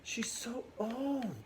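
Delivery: impatient Juliet. Retold or imitated. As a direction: whine like a bored impatient girl. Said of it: "she's just kinda complaining about the nurse".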